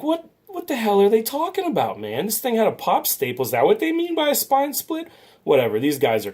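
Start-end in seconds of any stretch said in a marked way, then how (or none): 0.0-5.1 much higher mock-outraged voice, recounting his own disbelief; incredulous retort. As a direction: throw it up high like you can't believe it. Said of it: "So I'm like".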